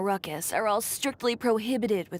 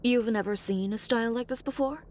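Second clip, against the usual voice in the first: ordinary talk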